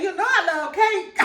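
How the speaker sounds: mama laughing. Retold or imitated. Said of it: "She said".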